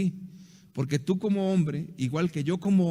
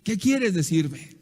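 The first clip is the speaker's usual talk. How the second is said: exasperated; 3 semitones higher, 3 dB louder, slower than usual. Retold or imitated. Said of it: "el hombre se desespera y dice".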